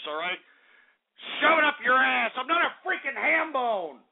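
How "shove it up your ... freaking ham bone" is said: high, defiant outburst; angry retort. Real, not acted. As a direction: throw the insult back in a high voice.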